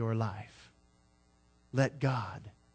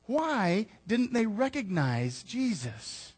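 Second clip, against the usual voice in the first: ordinary talk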